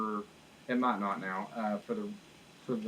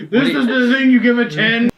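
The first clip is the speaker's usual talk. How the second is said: very high, loud, laughing disbelief; incredulous yell; 12 semitones higher, 16 dB louder than usual. Real, not acted. Co-host challenging the perfect rating, laughing incredulous yell. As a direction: yell it through laughter, scandalized.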